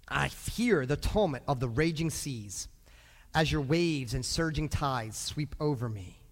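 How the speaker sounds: slow, low, solemn verse reading; measured, weighty recitation; scripture reading. Acted. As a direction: read it gravely, letting each phrase roll. Reads scripture.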